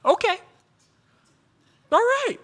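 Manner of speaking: high, chirpy okay, all right; high mock agreement. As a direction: play the wife going along brightly. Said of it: "Sarah is like".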